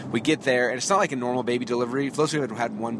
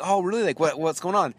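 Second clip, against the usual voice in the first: mock concern; 6 semitones higher than usual. Mock sympathy to tired friend.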